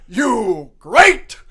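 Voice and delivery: loud, high monster praise; booming caveman-style judge, word by word; Frankenstein. Pretend. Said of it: "you'd have Frankenstein go".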